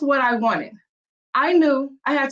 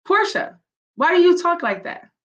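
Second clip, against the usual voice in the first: higher-pitched teacher calling out a pupil; acted teacher's challenge, raised pitch; critical teacher; 6 semitones higher, 4 dB louder than usual. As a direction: question the child like a teacher, pitched up. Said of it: "your teacher saying".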